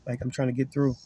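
soft, courteous request; polite gentleman. Retold or imitated. Preacher voicing a gentleman, soft polite ask. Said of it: "A gentleman say".

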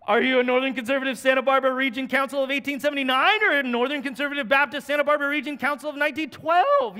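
firing off the endless denomination question; rattled off fast. Retold or imitated. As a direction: race through the names, building up. Rattling off long denomination list.

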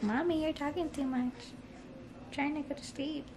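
mother playfully voicing her baby's complaint; baby daughter's voice. Mother voicing her fussy baby, a light high sleepy child voice. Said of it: "But she's like".

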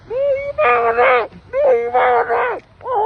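loud, tearful shout of joy; excited shout. Real, not acted. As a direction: cry out with overwhelmed joy. Shouting in awe at snow.

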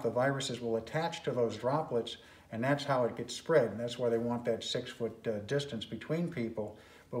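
muffled behind fabric; speaking through face covering; through a cloth mask. Real, not acted.

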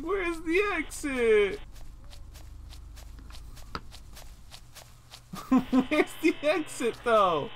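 high, drawn-out plaintive wail; distressed whine. Real, not acted. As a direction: whine desperately for the way out. Lost, cannot find the exit.

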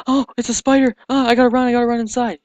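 loud, high panicked squeal; tryhard freakout. Retold or imitated. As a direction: overreact like a screaming tryhard. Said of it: "Person who goes".

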